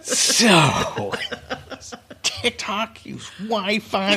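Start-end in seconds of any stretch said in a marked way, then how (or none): 2.2-4.2 high laughing mock questioner; smug questioner. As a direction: ask it smugly while laughing. Mimics congressman's question.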